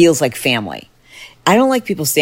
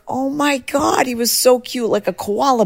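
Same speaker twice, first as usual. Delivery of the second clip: squealing delight; 10 semitones higher than usual. Retold or imitated. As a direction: squeal over the cute bear. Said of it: "And I'm like".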